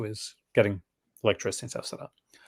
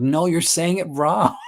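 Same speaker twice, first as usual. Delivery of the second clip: higher, slow mock correction voice; mocking outsiders; 7 semitones higher, 4 dB louder, slower than usual. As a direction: mimic the people correcting her accent. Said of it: "And they're like".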